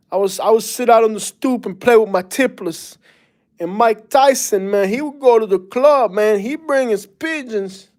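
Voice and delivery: high-pitched mock Bronx Puerto Rican accent. Imitating friends from the Bronx, in a Puerto Rican New York accent. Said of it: "they actually talk like that".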